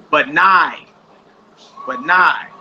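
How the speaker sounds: "but nigh" ending in an "eye" sound; long I ending. Shown as a demonstration. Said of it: "make an I sound".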